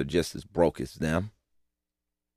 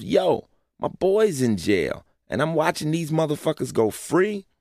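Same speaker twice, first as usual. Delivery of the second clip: higher, mildly outraged street voice; acted indignation, pitched up; indignant disbelief; 7 semitones higher than usual. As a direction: voice an onlooker's disbelief, pitch raised.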